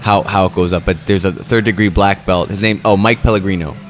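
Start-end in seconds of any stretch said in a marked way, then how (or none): none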